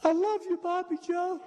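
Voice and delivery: imitating a weak old father on his deathbed; weakened dying voice. Dying father weakly tells the son-in-law he loves him. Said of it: "in a weakened voice".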